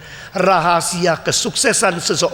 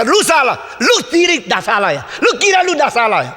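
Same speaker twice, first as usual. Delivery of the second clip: angry retort; 5 semitones higher than usual. Retold or imitated. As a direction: throw the blame straight back. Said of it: "Orang besar".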